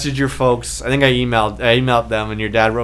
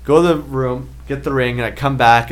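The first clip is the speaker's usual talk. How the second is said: ordinary talk, nothing marked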